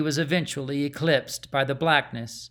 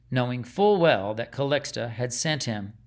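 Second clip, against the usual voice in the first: plain reading voice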